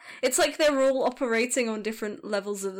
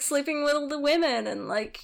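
normal talking voice

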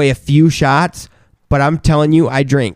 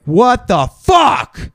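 slow, high-pitched outraged yell; retold freak-out; 11 semitones higher, much slower than usual. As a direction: replay your drunken outrage, words stretched out. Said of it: "I'm getting mad and I'm freaking out".

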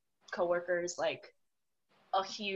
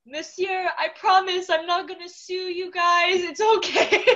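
high, loud retold promise, close to laughing; over-earnest reassurance; 8 semitones higher, 9 dB louder than usual. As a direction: reassure him loudly in a high, eager pitch. Retold reassurance to vice principal after seizure.

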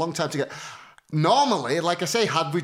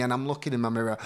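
normal talking voice

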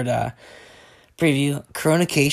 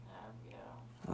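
whispering; 26 dB quieter than usual. Real, not acted. Whispered to sleeping wife.

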